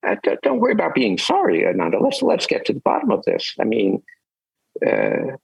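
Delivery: high, quick, kindly teacher voice; gentle Buddha. Retold or imitated. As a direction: coax him gently like a patient master. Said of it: "And the Buddha says".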